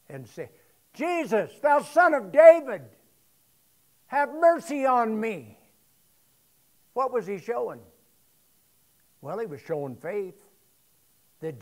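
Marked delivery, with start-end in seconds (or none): 0.9-5.6 raised pleading call, louder; a blind beggar calling out to Jesus for mercy; the beggar shouts to Jesus as he passes, pleading; crying out for mercy. Retold or imitated. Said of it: "began to cry out".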